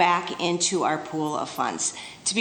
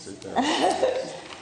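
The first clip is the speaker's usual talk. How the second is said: nervous laugh; 8 semitones higher, 3 dB quieter than usual. Real, not acted. Laughs at her own faintness.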